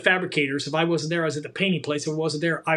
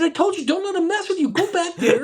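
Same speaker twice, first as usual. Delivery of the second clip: much higher voice breaking into laughter; laughing through it; 12 semitones higher, 4 dB louder than usual. Retold or imitated. Retelling McEwen scolding the kid, laughing through the words. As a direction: laugh while you retell it.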